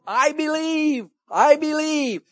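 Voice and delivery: loud, high, drawn-out declaration; exultant proclamation. Retold or imitated. Preacher echoing the morning song, exultant proclamation. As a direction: belt out each one in triumph.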